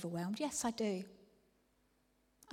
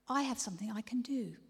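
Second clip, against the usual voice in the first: ordinary delivery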